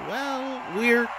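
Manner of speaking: high-pitched fight announcer; high, projected ringside call; match announcer. Acted.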